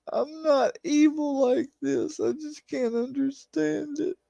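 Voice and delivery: high-pitched, anguished pleading; distraught plea. Real, not acted. Distraught plea at being harassed.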